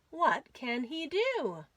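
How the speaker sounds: ordinary delivery, nothing marked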